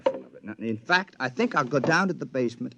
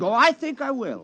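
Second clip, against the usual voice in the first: higher and louder, a gloating threat; menacing; 10 semitones higher, 5 dB louder than usual. Acted. Robot husband gloating at the men he holds at gunpoint. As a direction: sneer it with pleasure at their fear.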